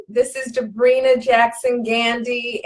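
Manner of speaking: normal talking voice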